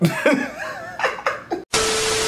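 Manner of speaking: squealing, airless giggle; high breathless laugh. Real, not acted. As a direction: wheeze with laughter. Laughing at himself.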